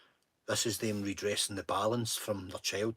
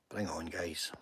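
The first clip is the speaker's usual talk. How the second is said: whisper; 5 semitones lower, 9 dB quieter, slower than usual. Real, not acted. Taunts trolls in chat.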